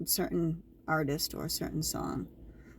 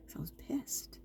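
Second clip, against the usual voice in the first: whispered; 6 semitones higher, 12 dB quieter than usual. Real, not acted. Telling viewers her anger.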